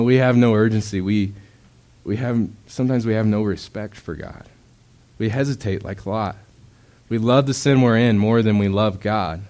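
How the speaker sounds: ordinary talk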